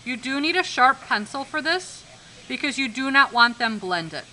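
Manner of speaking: ordinary delivery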